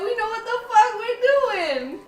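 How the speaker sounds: high, slow, crying with delight; gleeful tearful brag. Real, not acted. Praising their cooking, teary excitement. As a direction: stretch it out in happy tears. Overjoyed tasting.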